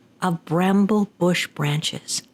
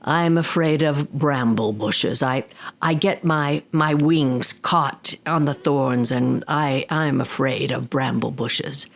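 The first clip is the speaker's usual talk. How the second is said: Tengu demon voice; 6 semitones lower than usual. Acted. Demon confessing his fear to the old woman, low. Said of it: "the Tengu simply said".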